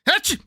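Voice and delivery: loud real sneeze. Real, not acted. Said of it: "I like sneezing now".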